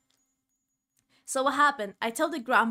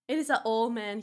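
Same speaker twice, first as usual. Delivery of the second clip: ordinary talk, nothing marked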